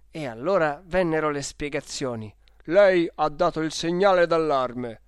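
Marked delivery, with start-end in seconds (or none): 2.6-5.0 loud, official challenge from a railway clerk; loud accusation. Acted. Railway clerk confronting passenger about the alarm, loudly accusing.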